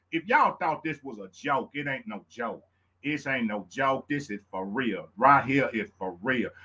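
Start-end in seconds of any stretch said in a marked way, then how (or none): none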